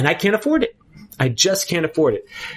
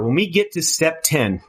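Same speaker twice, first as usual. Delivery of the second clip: usual speaking voice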